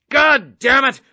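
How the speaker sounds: loud furious curse; angry outburst. Acted. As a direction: blow up at the cadet. Colonel explodes at the cadet.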